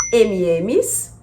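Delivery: slow, spelled out "M e M's" Portuguese style; M e M's the Brazilian way. Shown as a demonstration. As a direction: read M e M's letter by letter, slowly. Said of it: "A quarta".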